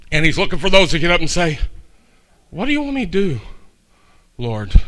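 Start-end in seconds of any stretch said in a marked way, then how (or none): 2.5-4.7 soft, much softer pleading to the Lord; hushed prayer. Acted. Pastor voicing a humble believer, hushed prayer. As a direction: drop to a near hush.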